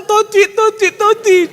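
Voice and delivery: high lilting imitation of the girlfriend; singsong it back at her mockingly; mock cutesy reply. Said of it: "Kamu bilang". (tiruan manja dengan nada tinggi)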